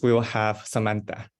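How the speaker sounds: normal talking voice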